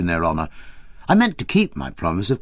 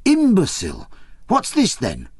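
excited scolding; 9 semitones higher than usual. A narrator's reading. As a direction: berate him, thrilled at the find. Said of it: "she cried out".

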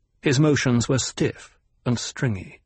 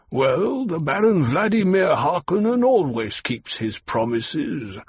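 the Baron, gloating villain. Acted. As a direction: toy with the doctor before having him killed. The Baron taunting the doctor.

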